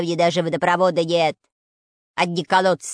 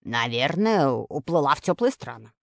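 grumbled; 6 semitones lower than usual. A narrator's reading. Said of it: "буркнул".

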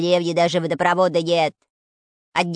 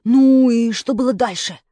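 louder, keen question; eager curiosity; 3 semitones higher, 7 dB louder than usual. A narrator's reading. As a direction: push for the rest eagerly. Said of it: "полюбопытствовал".

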